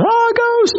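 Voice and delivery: high, startled gasp of a ghost sighting; startled cry. Retold or imitated. Disciples in the boat spotting a ghost, startled high yelp. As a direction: yelp it out like they've seen a ghost. Disciples see figure on water.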